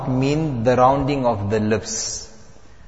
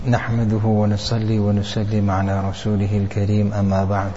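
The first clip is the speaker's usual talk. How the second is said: brisk recited Arabic blessing; opening invocation; 4 semitones lower, much faster than usual. Real, not acted. Teacher opens class with a brisk recited blessing. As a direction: rattle off the opening prayer quickly.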